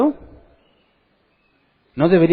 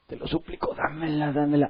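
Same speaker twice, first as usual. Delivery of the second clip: mock pleading whisper; 6 dB quieter than usual. Mocking a half-hearted prayer.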